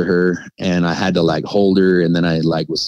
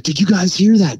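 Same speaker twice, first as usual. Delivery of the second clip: frantic question; 11 semitones higher than usual. Retold or imitated. Scared dad questioning kids.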